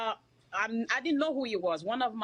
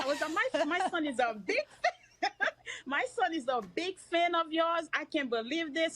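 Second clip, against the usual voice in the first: high, laughing retelling; excited laughter while retelling; laughing excitedly; 7 semitones higher than usual. Real, not acted. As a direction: giggle through the words, still thrilled.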